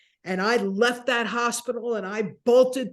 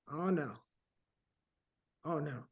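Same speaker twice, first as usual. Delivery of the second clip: softer, lower, crushed under the breath; quiet despair; 5 semitones lower, 11 dB quieter than usual. Retold or imitated. Turned away at the front desk, a soft crushed oh no. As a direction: let the hope drain out of it. Seminar already full, despair.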